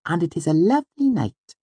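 high, dainty, decided voice; Auri. A narrator's reading. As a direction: declare it firmly in a tiny high voice. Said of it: "she said firmly".

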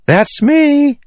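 very high-pitched answering call; Syl shouting back. A narrator's reading. Syl answers the spren up on the ship, shouting back. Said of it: "she shouted back".

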